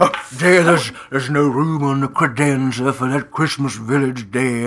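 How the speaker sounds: put-on fussy husband voice; fretting husband. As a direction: complain to dear as a worried husband. Plays fretting husband in a bit.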